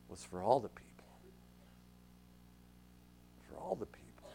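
near-whisper for the key point; hushed emphasis. Real, not acted. Preacher telling church the news is for everyone, hushed. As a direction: drop right down and let it sink in.

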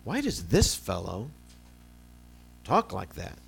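slow, drawn-out question with pauses. Retold or imitated. Pastor voicing the scribes' doubt to the congregation, slow and drawn out. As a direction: let each word hang as the scribes wonder. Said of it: "They say".

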